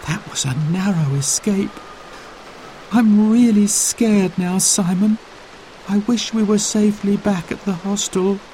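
lightly voiced scared young girl. A narrator's reading. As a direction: let her fear creep into the voice. Frightened girl in story.

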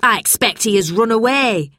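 a touch of scolding; snapped. A narrator's reading. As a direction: dismiss the boy's excuse sharply. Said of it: "snapped Missus Bleak".

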